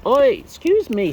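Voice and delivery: ordinary talk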